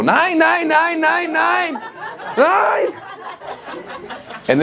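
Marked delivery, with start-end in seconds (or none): 0.0-2.9 shouting nine. Pretend. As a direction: let it all out in the woods, bellowing. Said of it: "you just go".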